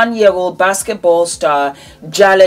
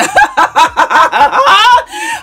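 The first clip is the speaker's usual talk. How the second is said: bright high-pitched burst of giggling; high giggle; 5 dB louder than usual. Real, not acted. Greeting her viewers with a squealing high giggle.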